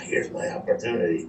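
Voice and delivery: low, soft, to himself; quietly scheming thought; sly inner thought. Retold or imitated. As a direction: hush it like a secret plan. Thought while partner looks away.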